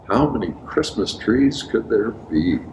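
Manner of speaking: ordinary delivery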